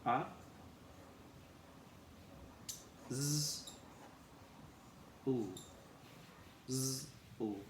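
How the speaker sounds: g, a, z, l said apart; sounding out guzzle. Shown as a demonstration. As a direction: piece guzzle together sound by sound. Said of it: "We say g".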